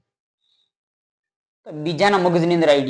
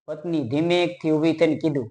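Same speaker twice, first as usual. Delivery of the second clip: ordinary delivery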